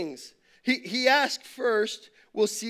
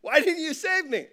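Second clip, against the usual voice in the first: higher, hurt whine to God; disappointed complaint; 6 semitones higher than usual. Retold or imitated. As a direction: play up the letdown, fast and aggrieved. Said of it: "he's kinda disappointed".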